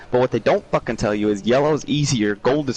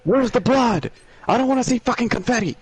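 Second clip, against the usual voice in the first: mock whiny complaint; 11 semitones higher than usual. Gamer imitating himself griping at the dodging boss, high and whiny.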